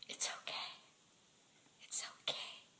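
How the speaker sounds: soft, gentle whispered comfort; soothing whisper. Retold or imitated. Hand on his heart, calming him with a soft whisper. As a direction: hush him softly like a frightened child.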